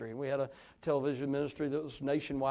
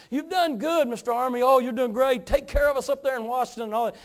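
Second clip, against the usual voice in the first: higher-pitched, gushing hometown praise; backslapping congratulations; 10 semitones higher, 8 dB louder than usual. Retold or imitated. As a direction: pile on the praise like proud church folk. Congregation praising him in a retold story.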